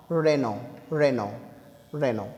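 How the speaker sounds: "रेनो" drawn out, no l or t; slow Reno drill. Shown as a demonstration. Teacher drilling students on the word Renault. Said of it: "बोलते हैं इसे रेनो".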